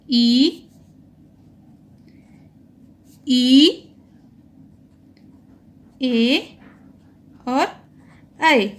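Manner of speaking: teacher modelling the unrounded vowels अ आ ई ए ऐ for students; vowel demonstration. Shown as a demonstration. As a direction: say अ आ ई ए ऐ slowly so the class can copy. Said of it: "आप बोल के देखिए एक बार साथ में".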